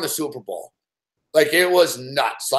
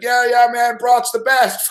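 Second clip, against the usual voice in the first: put-on telling the brother what he wants to hear, drunkenly; drunken eager agreement; 7 semitones higher, slower than usual. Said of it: "I'm all drunk".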